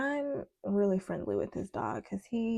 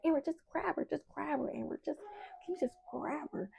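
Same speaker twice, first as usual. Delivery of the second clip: nagging; 7 semitones higher, 4 dB quieter than usual. Retold or imitated. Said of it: "nagging me".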